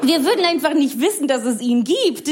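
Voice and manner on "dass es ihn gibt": ordinary talk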